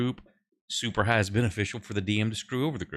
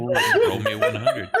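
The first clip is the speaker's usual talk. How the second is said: laughing plea; 7 dB louder than usual. Real, not acted. Asks chat for a roll.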